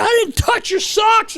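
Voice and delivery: high-pitched imitated protest, drawn out; hammed-up shouted denial, way up high; mock indignant shout. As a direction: yell it like a wronged teammate, half laughing. Acted protest of angry teammate.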